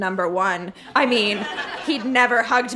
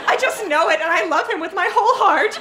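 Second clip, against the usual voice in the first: higher, laughing through the words; giddy teenage declaration of love; lovestruck gush; 8 semitones higher than usual. Acted. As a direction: swoon over him. Lovestruck gushing about Tyler.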